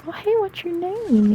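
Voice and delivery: put-on high cutesy greeting for a five-year-old; cute talking-to-kids voice. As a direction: coo it up high to a small child. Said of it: "you do a cute little, little kid voice".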